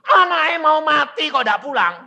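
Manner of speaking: high, loud reproach; acted indignant neighbour; scolding neighbour. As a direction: cry it out as a shocked neighbour. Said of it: "Tetangga bilang gini".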